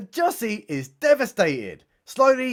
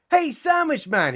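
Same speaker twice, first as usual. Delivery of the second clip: high call across the shop; calling over; 10 semitones higher than usual. Acted. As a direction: call out to the man behind the counter. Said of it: "he calls over".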